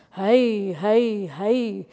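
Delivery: long drawn-out wailing heys; devilish call. Acted. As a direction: call out like a spook in the night woods. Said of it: "mit teuflischer Stimm". (teuflisch langgezogenes Rufen)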